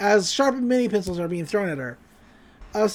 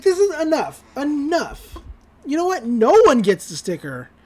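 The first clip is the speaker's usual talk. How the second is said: higher-pitched, emphatic putting-foot-down; mock exasperation; 4 semitones higher than usual. [name] to squabbling golf balls, mock exasperated. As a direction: lightly act out calling a halt.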